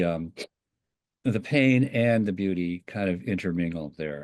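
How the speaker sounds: ordinary talk, nothing marked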